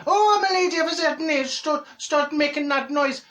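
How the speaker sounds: high, loud put-on outburst. Neighbour chatting to the wall about the noise, high mock protest. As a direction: pitch it right up in mock complaint. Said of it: "When did I start speaking like that?".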